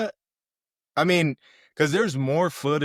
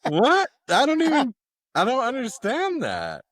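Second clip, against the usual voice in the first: can't believe what she just heard; real incredulous outburst; baffled disbelief; 10 semitones higher than usual. Real, not acted. Baffled by the slur claim.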